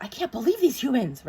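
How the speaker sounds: high, loud mock disbelief; amused, incredulous outburst; exasperated angels. Acts out exasperated angels watching humans.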